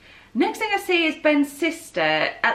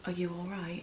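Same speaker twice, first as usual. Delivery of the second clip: much softer, murmured check-in; hushed concern; 4 semitones lower, 15 dB quieter than usual. Retold or imitated. Boyfriend checking on her in the car, hushed. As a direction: ask it low and gentle. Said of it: "you just turned to me and went".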